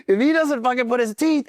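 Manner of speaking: high-pitched drill-instructor threat; acted, strained ultimatum; mock aggressive instructor. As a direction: imitate an instructor starting to lose patience. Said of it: "he's getting more aggressive".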